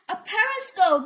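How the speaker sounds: high, loud exclamation; excited, surprised call; crying out. A narrator's reading. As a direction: cry out in discovery. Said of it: "Minnie cried".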